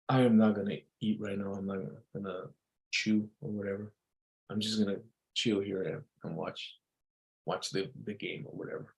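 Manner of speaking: very soft, low-key answer; calm reply. Shown as a demonstration. Boyfriend giving in over chewing, quiet calm voice.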